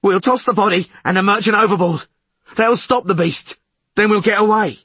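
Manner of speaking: high, loud, rough rasp; rasping insistence. A narrator's reading. Mutinous sailor telling witcher the plan, loud rasp. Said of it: "rasped the tallest of them".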